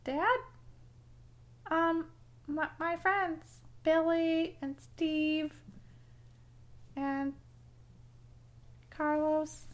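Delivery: put-on child voice. Kid caught by his dad.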